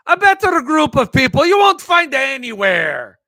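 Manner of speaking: high, loud toast proclaimed; toast shouted. Shown as a demonstration. Host repeats the toast to Jeff heads, loudly proclaimed. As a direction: raise a glass and bellow it. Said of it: "That sounds like an Irish toast".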